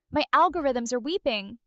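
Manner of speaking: ordinary delivery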